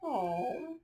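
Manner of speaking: soft, pitying coo; sympathetic. Real, not acted. Showing children the sad dog picture, soft and pitying. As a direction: feel sorry for the little dog.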